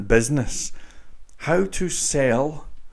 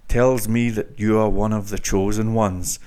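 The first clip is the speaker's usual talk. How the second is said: normal talking voice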